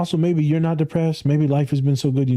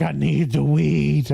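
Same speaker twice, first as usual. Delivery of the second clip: slow, drawn-out mock plea for weed; a hint of a desperate client; mock craving client; 2 semitones higher, slower than usual. Client voiced in anecdote.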